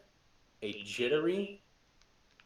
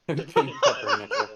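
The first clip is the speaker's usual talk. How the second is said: laughing high while repeating the name; 7 dB louder than usual. Real, not acted. Player laughs at the character name, laughing. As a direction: giggle the name back, delighted. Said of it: "I like that".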